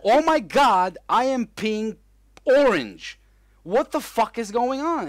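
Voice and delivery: loud, high panicked outburst; panicked yell. Retold or imitated. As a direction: freak out loudly at the sight. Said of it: "you might go".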